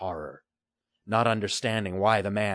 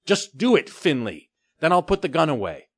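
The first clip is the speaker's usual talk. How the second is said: put-on a boy barking orders, higher and louder; commanding order; 8 semitones higher, 7 dB louder than usual. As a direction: make him obey now. Boy ordering pirate at gunpoint.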